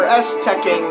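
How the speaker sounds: ordinary talk, nothing marked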